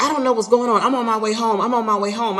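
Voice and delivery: high rattled excuse; flustered husband. Retold or imitated. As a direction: play the flustered husband dodging.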